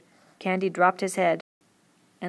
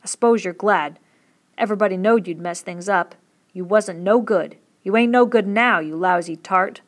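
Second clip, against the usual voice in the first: vicious; 8 dB louder than usual. A narrator's reading. Candy raging at Curly's dead wife, vicious and loud. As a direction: spit the insults at her. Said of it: "he said viciously".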